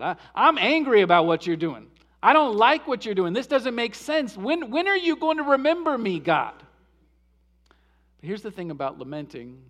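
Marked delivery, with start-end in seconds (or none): 0.0-6.5 loud, raw complaint to God; angry psalm prayer. Shown as a demonstration. Preacher voicing the psalmist complaining loudly to God. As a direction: demand answers from God. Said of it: "They just tell God".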